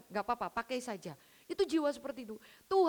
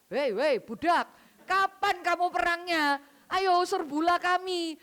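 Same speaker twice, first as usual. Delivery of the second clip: mocking taunt; 5 semitones higher, 6 dB louder, slower than usual. Mocking Jericho people taunt.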